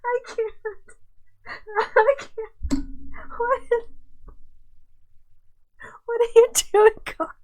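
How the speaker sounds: breathless, high-pitched giggly words; barely getting words out through laughter; laughing. Real, not acted. To viewers, cracking up at Carl's video.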